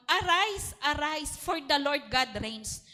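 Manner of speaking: loud, slow proclamation; booming call to rise; commanding voice of God. Retold or imitated. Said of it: "Sabi ng Panginoon".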